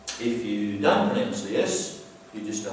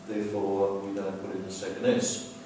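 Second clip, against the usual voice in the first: ordinary talk, nothing marked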